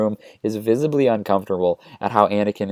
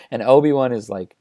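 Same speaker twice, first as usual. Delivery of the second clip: ordinary delivery, nothing marked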